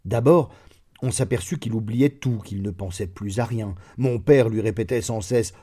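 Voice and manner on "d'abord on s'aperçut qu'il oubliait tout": ordinary delivery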